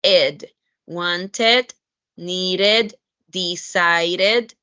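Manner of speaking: wanted, needed, decided each with a full -ed syllable; teacher modelling the extra -ed syllable. Shown as a demonstration. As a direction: sound ed as its own beat. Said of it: "you will pronounce".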